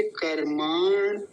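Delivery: कर्माण slow, stopping on the half ण. Shown as a demonstration. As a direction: stretch मा in कर्माण, then close on ण. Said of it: "णकार आधा है".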